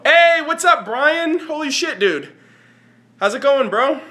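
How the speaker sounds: high, loud, thrilled hello; excited greeting. Real, not acted. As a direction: greet a friend with a burst of joy. Excited greeting to a chat viewer.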